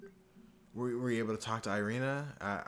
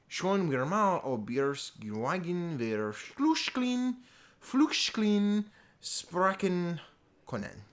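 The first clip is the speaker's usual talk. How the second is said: very slow, loud, high mangled German; attempted German; 8 semitones higher, 5 dB louder, much slower than usual. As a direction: sound out German words laboriously, pushing each one. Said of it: "I don't speak German, but I'm trying".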